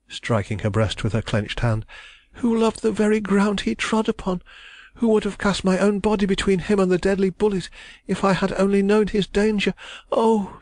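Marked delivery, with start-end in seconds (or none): 2.3-10.6 put-on distressed young woman. A narrator's reading. Young woman protesting her love, high and anguished. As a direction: cry it out as the wronged heroine. Heroine's voice.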